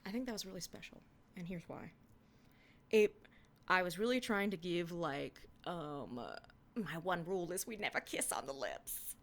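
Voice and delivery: usual speaking voice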